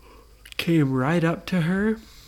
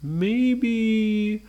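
pondering Frankenstein; 3 semitones higher, much slower than usual. Acted. Frankenstein reconsiders.

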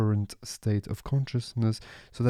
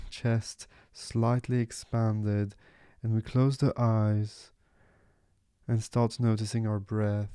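guided meditation. Real, not acted. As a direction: ease listeners gently into stillness, letting each phrase settle.

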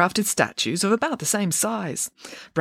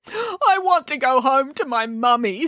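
high, slow whine; tearful wail; 9 semitones higher than usual. Acted. Crying puppet answers the fairy, tearful wail. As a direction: plead like a homesick child. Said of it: "Why are you crying".

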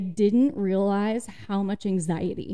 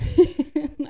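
short jittery giggle; nervous laugh; 7 semitones higher, 7 dB louder than usual. Real, not acted. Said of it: "As I nervous laugh".

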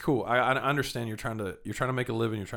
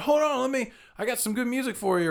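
much higher mock voice calling after him; imitated street hustler; 12 semitones higher than usual. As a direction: call after someone walking past you.

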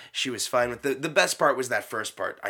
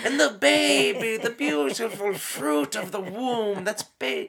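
high, loud joyful gush; imitating the nun's delight; 9 semitones higher, 6 dB louder than usual. Nun delighting in the first part, high and loud. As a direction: gush about the baby like the nun. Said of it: "She said".

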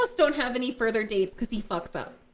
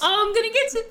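mock crude voice; 9 semitones higher, faster than usual. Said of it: "Why are you saying it like".

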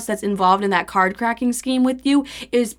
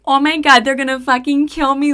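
higher, much louder self-centred panic; panicked outburst; 5 semitones higher, 5 dB louder than usual. Retold or imitated. Telling her ex she will be killed, a loud panicked cry. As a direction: shriek it like your life is over. Over here narcissistic, panicking.

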